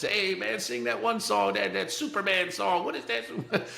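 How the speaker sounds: high call down the hallway asking for a song; inmates calling out. Retold or imitated. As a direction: shout a song request down the cell block, pitched high.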